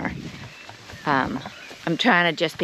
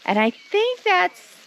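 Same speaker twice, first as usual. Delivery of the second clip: high cooing voice; 13 semitones higher, slower than usual. Real, not acted. Voice jumps up, sheep arrive.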